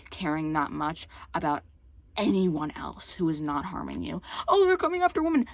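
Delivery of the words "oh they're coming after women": high-pitched mock alarm; mocking imitation. Mimicking transphobes' excuse about women, mocking. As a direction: parrot their panic up high.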